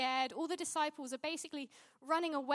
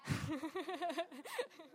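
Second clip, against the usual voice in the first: rippling amused chuckle rising at the end; real laugh; 5 dB quieter than usual. Real, not acted. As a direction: laugh at your own aside. Laughs at own aside about food.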